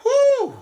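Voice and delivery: high loud exasperated whew. Real, not acted. Recalling the insult, a loud high whew. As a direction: blow it out loud in disbelief. Exasperated outburst before rant.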